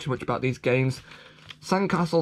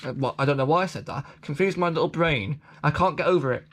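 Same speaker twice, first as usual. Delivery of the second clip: ordinary talk, nothing marked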